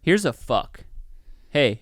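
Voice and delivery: normal talking voice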